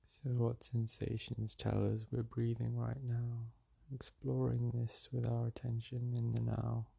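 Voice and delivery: calm, unhurried meditation voice with long pauses; soothing meditation guide, leaving space between phrases; guided meditation. Acted. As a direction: guide them gently back to the breath.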